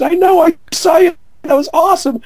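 very high, loud glee; retold gleeful laughing reply. Jimmy on the phone after watching the search, high gleeful shout. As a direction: crow it high and loud, hugely amused. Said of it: "he's laughing his ass off and goes".

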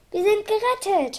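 high, lifted cry of joy; joyful relief. A narrator's reading. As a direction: rejoice at being rescued. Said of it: "freute sich der Doktor". (hoch und freudig gerufen)

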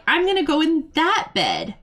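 high, cheeky voice for a pet dog; voicing the dog Bessie. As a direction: speak for the dog in a high, bratty tone. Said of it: "and Bessie's like".

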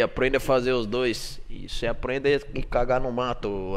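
usual speaking voice

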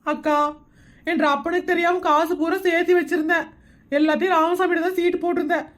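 tearful complaint; crying. Acted. Woman tells her friend the chit man ran off with savings, crying. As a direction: pour it out through tears. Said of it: "இதுக்கு அழுகுறது?".

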